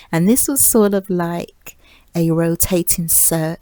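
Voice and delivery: ordinary delivery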